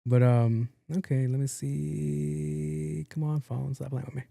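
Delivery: ordinary talk, nothing marked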